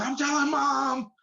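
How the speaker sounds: put-on high, slow tattletale kid voice; bratty little sister. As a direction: whine it like a kid sister threatening. Little sister threatens to tell.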